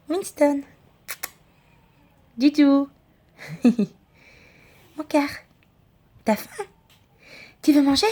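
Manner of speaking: high, sing-song questions to a pet. Real, not acted. She asks her cat Winston if he is hungry, high sweet baby talk.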